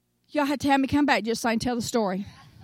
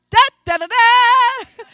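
triumphant inner fanfare; 8 semitones higher, 10 dB louder, slower than usual. Real, not acted. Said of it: "in my mind".

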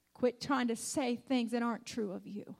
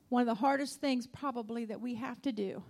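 ordinary delivery

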